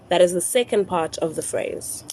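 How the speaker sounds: ordinary talk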